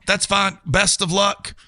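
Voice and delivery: usual speaking voice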